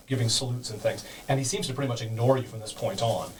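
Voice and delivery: ordinary delivery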